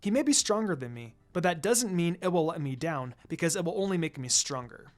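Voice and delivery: usual speaking voice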